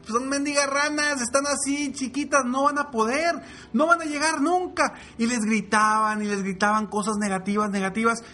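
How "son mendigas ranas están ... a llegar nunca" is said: very high mocking shouted jeers; jeering animals. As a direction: heckle the little frogs. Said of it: "seguían gritando". (burla aguda a gritos)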